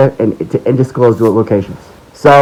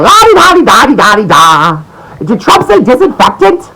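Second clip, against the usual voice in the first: mocking sing-song; 9 semitones higher than usual. Mocking complacent viewers.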